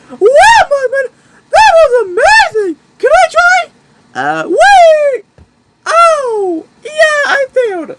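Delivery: put-on young cup trying a trick; excited squeaky voice. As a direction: bounce with excitement. Excited kid watching backflip.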